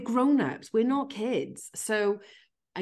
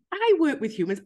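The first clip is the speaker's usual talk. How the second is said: high, louder mock voice of a colleague; mock earnest quote; 11 semitones higher, 7 dB louder than usual. Colleague parroted to the host in a high mock voice. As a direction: lightly send up the sector people.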